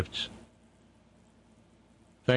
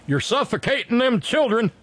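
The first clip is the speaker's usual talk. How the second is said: high-pitched, hammed-up outcry; mock scolding protester; 13 semitones higher, 3 dB louder than usual. Host imitating someone objecting to masks, high mock outcry. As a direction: mimic an indignant busybody, pitched way up. Mocking quote of an anti-mask scold.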